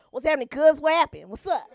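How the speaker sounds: put-on loud, high tough-guy street accent; gang slang accent. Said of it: "you need the gangbang accent".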